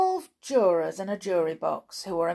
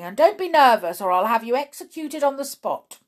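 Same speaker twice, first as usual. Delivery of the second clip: loud, raised royal threat; threatening king; 6 semitones higher, 8 dB louder, faster than usual. A narrator's reading. King threatening the nervous Hatter with execution, loud menace. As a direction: threaten loudly at a raised pitch.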